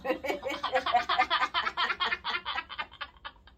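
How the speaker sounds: long high giggle; high laugh. Real, not acted. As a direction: burst out laughing. Cracking up at typo.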